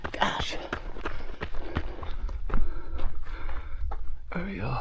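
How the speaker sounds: breathy whisper to himself. Real, not acted. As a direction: whisper it under your breath. Quiet reaction on the trail.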